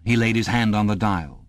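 usual speaking voice

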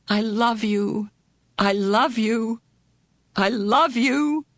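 slow, rising through tears; tearful repetition. A narrator's reading. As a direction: sister holding her brother, repeating her love. Meg sobbing embrace repeated.

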